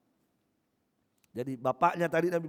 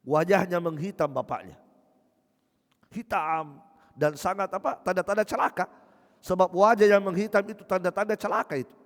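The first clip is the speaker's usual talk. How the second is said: normal talking voice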